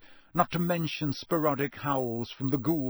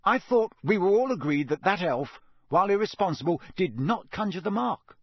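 heated, raised objection; hot; 6 semitones higher, 5 dB louder than usual. A narrator's reading. Son arguing with father, heated. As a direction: push back hotly, louder. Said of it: "said Percy hotly".

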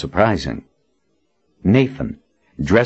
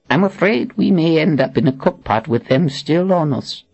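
put-on dry, higher old wizard; wry old man; 10 semitones higher than usual. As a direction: say it dryly. Zed wry reply.

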